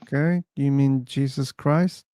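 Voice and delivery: ordinary talk, nothing marked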